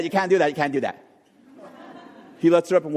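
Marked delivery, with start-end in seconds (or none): 0.0-1.0 put-on fast, bossy little girl; girl protesting. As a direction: rattle it off like a bossy sister. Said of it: "And she goes".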